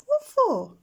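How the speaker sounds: put-on a baby owl puzzled; curious owlet. A narrator's reading. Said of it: "Plop asks".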